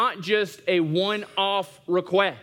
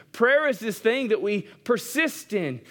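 ordinary talk, nothing marked

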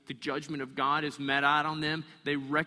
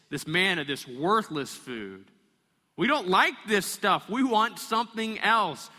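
complaining; 6 semitones higher, 6 dB louder than usual. Acted. Israelites complaining to Moses.

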